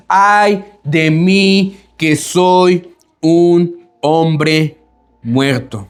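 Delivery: muy lento, apagándose a un hilo; lamento de Isaías. Retold or imitated. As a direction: deja morir la voz de dolor. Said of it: "la expresión que dice Isaías". (Isaías se lamenta, muy lento y cada vez más suave)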